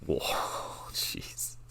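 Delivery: stunned whisper. Real, not acted. Looking up a photo, impressed.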